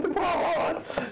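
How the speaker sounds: very high, loud mimicked wail; mock distressed squeal. Player mimics friend fretting over horse, mock squeal. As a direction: imitate someone squealing in pity. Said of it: "You're like".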